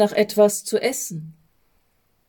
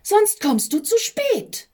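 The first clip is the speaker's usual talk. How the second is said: much higher, odd nagging voice; hurried warning; 11 semitones higher than usual. A narrator's reading. Stress warns the envoy he'll be late, high nagging. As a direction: warn him he'll be late, piping high. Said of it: "riefen da der Stress und die Hektik".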